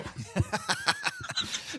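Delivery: bursting into high laughter; cracking up at her own joke. Real, not acted. Laughing hard at her own impression of his past.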